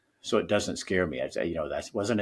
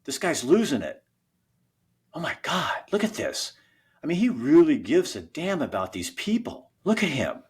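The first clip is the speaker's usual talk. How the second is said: amazed engineer; 8 semitones higher than usual. Retold or imitated. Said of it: "we heard the engineers in New York City going".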